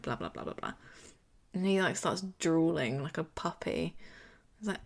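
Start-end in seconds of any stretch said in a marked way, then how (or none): 0.0-0.7 lowered mumbling. Retold or imitated. Mocking rambling excuse.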